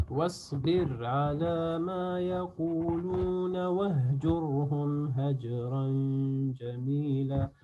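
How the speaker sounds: slow chanted verse on long held notes; Quran recitation. Shown as a demonstration. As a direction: melodic recitation with drawn-out vowels.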